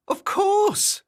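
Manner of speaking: very high, loud exclamation; gleeful creature voice, pitched right up; eager agreement. Acted. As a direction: agree heartily, stretching it out. Cheerful wobbler readily agrees.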